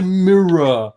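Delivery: mirror said as a slow, full "mir-ruh"; German pronunciation. Shown as a demonstration. German explaining a hard word, mirror in two full syllables. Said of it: "we pronounce it".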